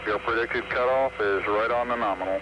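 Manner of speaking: crackly mission radio; over a radio link; radio transmission. Real, not acted. Mission control radio loops.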